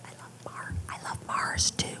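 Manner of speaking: hushed, secretive whisper; whispered confession. Pretend. Admitting a love of Mars on the sly, whispered. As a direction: whisper it as if she mustn't overhear. Confiding a secret about a favorite.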